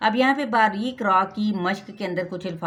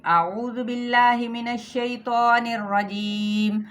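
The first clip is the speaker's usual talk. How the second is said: slow, louder, melodic tilawat; Quran recitation; 3 semitones higher, 4 dB louder, much slower than usual. Acted. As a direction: recite the verses slowly, chanting. (तिलावत के अंदाज़ में पढ़ना)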